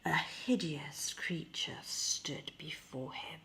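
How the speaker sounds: usual speaking voice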